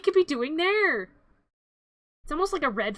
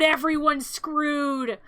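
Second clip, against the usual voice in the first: ordinary delivery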